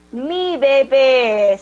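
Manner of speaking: put-on mi bebé cooed slowly like to a baby. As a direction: coo it tenderly to an infant. Tender word about a baby. (mi bebé, con ternura y lento)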